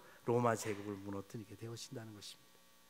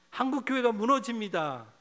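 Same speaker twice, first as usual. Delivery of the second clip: louder, higher cry of alarm; 8 semitones higher, 6 dB louder than usual. Pretend. Preacher praying for the church, crying out loud. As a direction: cry it out to God. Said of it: "탄식하기를 원합니다".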